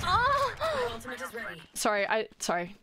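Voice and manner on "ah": sharp high yelp of surprise; short high startled cry. Real, not acted. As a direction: jump and yelp. Sudden startled cry in game.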